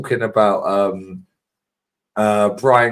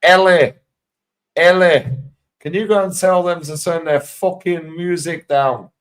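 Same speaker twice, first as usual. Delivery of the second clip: a higher, louder mock call in a northern Clough-style accent; Brian Clough accent, calling out; 6 semitones higher, 3 dB louder than usual. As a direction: shout across the dressing room in a put-on Clough accent. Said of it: "nails his fucking accent".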